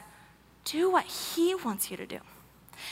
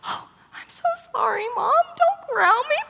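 tearful shame; 11 semitones higher, 4 dB louder than usual. Retold or imitated. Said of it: "So it's like".

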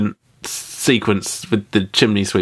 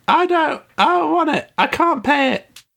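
hammed-up squeaky pleading; helpless borrower. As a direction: plead it way up high, comically helpless. Acting out subprime borrower.

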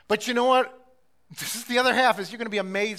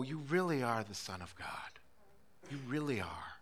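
softer, lower, reverent murmur of faith; hushed awe; 5 semitones lower, 9 dB quieter than usual. Acted. Preacher quietly confessing faith to God, hushed. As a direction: breathe it out in quiet wonder. Same words repeated softly.

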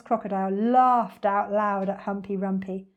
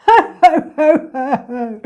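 big, booming "ho ho ho"; high, loud storybook villain laugh; loud mocking laugh; 6 semitones higher, 15 dB louder, slower than usual. A narrator's reading. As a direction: laugh out loud in scorn, trailing down. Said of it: "cried the enormous crocodile".

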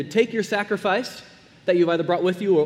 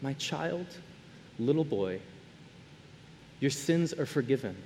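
soft, gentle, much quieter voice; tender Jesus; 4 semitones lower, 8 dB quieter than usual. Acted. Jesus forgiving the ashamed man, soft and tender. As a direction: speak it softly, like a loving father to a child. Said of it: "when Jesus looks at this man and says".

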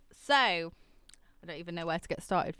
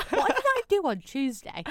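confused friend; 10 semitones higher, 6 dB louder than usual. Retold or imitated. Friend confused, repeating question.

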